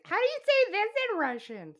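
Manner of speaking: high, loud mock asking voice; chirpy pleading question; imitating herself asking. Host imitating herself asking her Russian friend, high. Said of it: "and be like".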